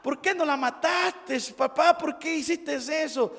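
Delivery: put-on high upset child's cry; girl crying out. Girl protests to her father. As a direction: call out in high alarm. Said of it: "la niña volvió a gritar".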